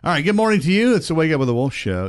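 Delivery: upbeat radio host intro. Acted. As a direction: punch it like a morning DJ.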